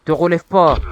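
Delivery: loud command to stay down; threatening shout. Real, not acted. Gamer shouting at a downed foe, loud. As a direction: bark it down at him.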